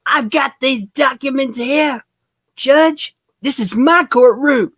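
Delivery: high, mocking whiny lawyer. Streamer mocking the lawyer, high and whiny. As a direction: squeak it out like an indignant lawyer. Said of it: "she's like".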